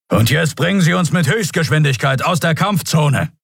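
high, urgent order to the pilot; commander's high-pitched urgent order; urgent command. A narrator's reading. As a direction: order a retreat from battle.

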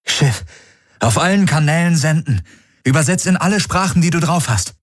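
high-pitched urgent command; commanding order. A narrator's reading. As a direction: order the ship's computer with urgency. Captain gives orders to the ship.

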